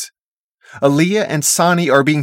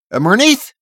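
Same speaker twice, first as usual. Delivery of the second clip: calling out, pitched up; shouted call; 7 semitones higher, 4 dB louder than usual. A narrator's reading. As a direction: call out the name, higher, rising at the end. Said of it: "Connor shouts".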